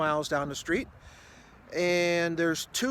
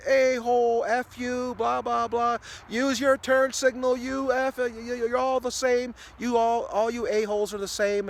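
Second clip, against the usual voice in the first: imitating her screaming; 7 semitones higher than usual. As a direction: ham up a furious driver's screaming. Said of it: "she just keeps going".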